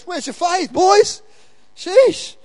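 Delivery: teasing rebuke. Acted. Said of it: "He goes".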